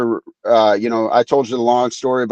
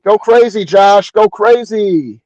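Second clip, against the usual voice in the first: higher, louder cheer, the last go crazy slowed; 7 semitones higher, 4 dB louder, slower than usual. Acted. Co-host cheering Josh on like a fan, loud high cheer. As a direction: egg the player on like a fan.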